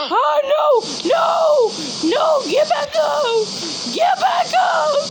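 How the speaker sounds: high, urgent wailing shouts; panicked high cries. Acted. [name] urging his character up, high panicked shouts. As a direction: plead urgently at the fallen character.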